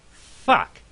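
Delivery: high, loud yelp of disgust; sudden shout. Acted. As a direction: blurt it out as a startled shout. Sudden outburst at the smell.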